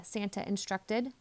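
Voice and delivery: usual speaking voice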